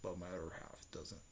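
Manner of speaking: low, soft, ghostly whisper; Nine Tails whispering inside Sakura's mind, eerie; whispering demon fox. Retold or imitated. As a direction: breathe it like a monster in her head.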